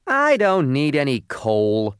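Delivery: loud, high rude dismissal; loud, scowling restaurant owner. Acted. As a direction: turn it away loudly, scowl in the voice. Rude owner rebuffs the coal.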